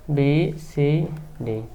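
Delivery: slow, spaced-out letters; name each letter slowly, leaving space between; spelling out while writing. Real, not acted. Teacher labels the rectangle's corners, spelling out slowly.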